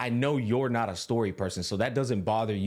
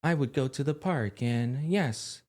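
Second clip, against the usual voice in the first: mock monotone line reading, slightly raised pitch; monotonous voice actor; 3 semitones higher than usual. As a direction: imitate an English dub actor delivering every line alike. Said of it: "sounded incredibly monotonous".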